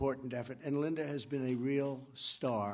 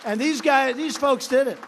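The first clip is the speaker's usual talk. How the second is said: much louder and higher, rousing the crowd; loud rally call; 9 semitones higher, 12 dB louder than usual. Real, not acted. Crowd-pleasing shout praising the congressmen, loud and high. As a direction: belt it out to the hall.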